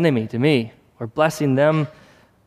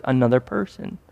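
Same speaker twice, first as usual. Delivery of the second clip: ordinary talk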